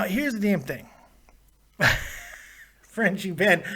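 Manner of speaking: ordinary talk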